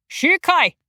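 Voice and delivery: loud barked call, high and sharp. A narrator's reading. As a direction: bark the name across the room. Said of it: "barked loudly".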